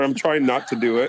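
usual speaking voice

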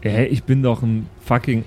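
ordinary talk

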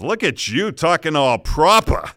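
mock an old friend who's gone proper, Boston style; Boston buddy teasing. Hometown friend digging a hole teases Dave, thick Boston accent. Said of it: "And he says".

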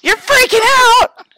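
huge, high, drawn-out shout; mock hysterical yell. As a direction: play along with the pretend meltdown, screaming slowly. Joins the mock freak-out.

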